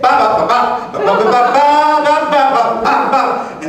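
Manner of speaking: chanted percussive ba syllables for a nightstick; club blows imitated. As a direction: beat out the club strikes with your voice.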